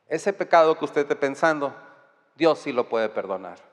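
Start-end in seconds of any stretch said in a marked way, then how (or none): none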